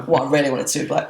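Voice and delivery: ordinary talk, nothing marked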